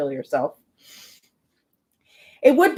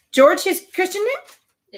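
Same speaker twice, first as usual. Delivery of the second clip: surprised; 11 semitones higher, slower than usual. Real, not acted. Surprised question.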